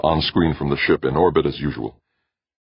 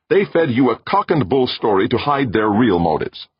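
high, loud accusation; accusing; 9 semitones higher, 5 dB louder than usual. A narrator's reading. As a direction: throw the charge at him, voice raised. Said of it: "almost accusingly".

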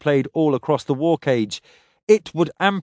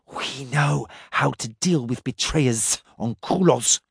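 muttered hiss. Acted. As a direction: mutter it like a hissing creature. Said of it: "the Shurugga muttered".

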